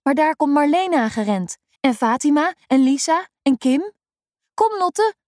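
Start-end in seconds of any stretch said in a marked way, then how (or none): 4.5-5.1 put-on high, bright girls' call across the playground. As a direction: call her over, pitched way up. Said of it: "roepen ze".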